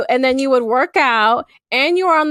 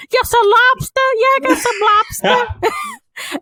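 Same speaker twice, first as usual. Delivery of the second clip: put-on much higher, slow Boston accent, lobster stretched; mock Boston accent; 10 semitones higher, slower than usual. Friend imitating a Boston local offering lobster in a mock Boston accent. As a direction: ham up a Boston lobster seller. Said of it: "Is that how they talk?".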